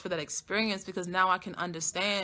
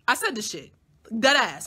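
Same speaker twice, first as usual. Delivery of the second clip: usual speaking voice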